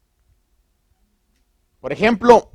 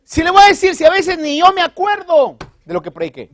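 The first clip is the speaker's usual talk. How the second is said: excited confession; 11 semitones higher, 5 dB louder than usual. Real, not acted. Pastor jokes he forgets sermons.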